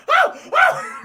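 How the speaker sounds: high loud panicked yelps; startled cry. Acted. Bruce under cat attack, high loud panicked yelps. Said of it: "Bruce's like".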